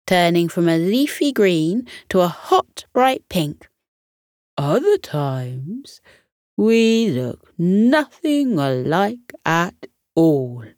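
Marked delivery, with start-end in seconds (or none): 4.6-10.6 drawn out slowly for the joke; slow playful reveal. Acted. Chameleon Camo to his friends, a slow teasing reveal. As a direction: stretch it like a punchline. Camo jokes while changing colour.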